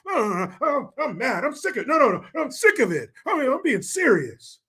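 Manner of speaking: high-pitched, worked-up parody of a politician; mock politician impression. Host mimics the politician he just played, mock-indignant high voice. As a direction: protest in a put-on high, pleading voice. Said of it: "Who's that sound like?".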